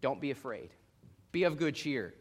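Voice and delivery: calm them like a steady friend; reassuring Jesus. Retold or imitated. Jesus speaking to terrified disciples, low soft reassurance. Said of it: "and says".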